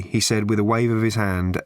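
plain reading voice

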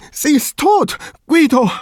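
high, loud, grief-stricken wail; suppressed sobs. A narrator's reading. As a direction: break the terrible news, close to sobbing. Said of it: "zwischen unterdrückten Schluchzern". (verzweifelt, unter Schluchzern)

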